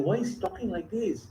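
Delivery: distressed, hushed. Acted. Rukmini stunned by her husband's teasing, softly distressed. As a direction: barely get it out, hurt. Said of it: "distressed that Krishna talking like".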